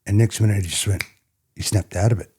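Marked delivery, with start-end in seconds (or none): none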